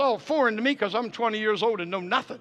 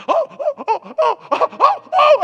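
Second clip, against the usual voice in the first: high, loud puffed "oh"s; imitated labor cries; 10 dB louder, slower than usual. Husband mimicking wife mid-contraction, imitated cries. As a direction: mimic mounting high-pitched labor panting. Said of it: "And she's like, and she started".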